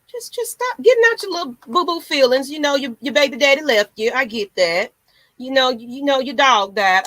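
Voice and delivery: loud, high tell-off to whiny fans; scolding rant. Real, not acted. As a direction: scold them to quit crying.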